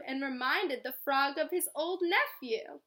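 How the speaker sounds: lively read-aloud storytelling; story narration. A narrator's reading. Teacher reading a story to children, lively. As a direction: read it like a bedtime picture book.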